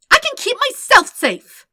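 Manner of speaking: high, loud indignant yell; furious high shout. Acted. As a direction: shout it, offended. Waterbender shouting back at Zuko.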